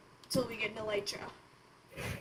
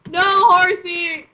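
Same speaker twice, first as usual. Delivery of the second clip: mock cry; 6 semitones higher, 17 dB louder, much slower than usual. Mock alarm for horse.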